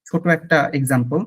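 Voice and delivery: ordinary talk, nothing marked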